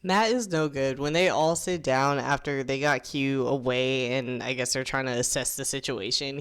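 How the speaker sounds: normal talking voice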